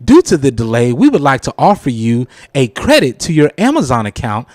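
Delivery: usual speaking voice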